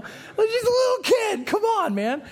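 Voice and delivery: high, loud, slow whiny protest; mock pleading crowd. Teens protesting the kitten story, high mock whine. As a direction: plead like outraged teenagers. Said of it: "we're like".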